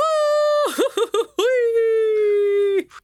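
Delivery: loud high yodeling Goofy shout; Goofy's yelling "hyuck" holler. Shown as a demonstration. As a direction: let rip Goofy's whoop. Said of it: "I can do, like, the whoo, whenever he just shouts".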